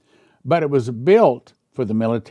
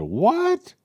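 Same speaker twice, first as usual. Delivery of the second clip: high, incredulous what; shocked what; 13 semitones higher than usual. Retold or imitated. Told by the repairman no washer exists, high shocked what. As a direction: squeak it out in disbelief. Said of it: "I said".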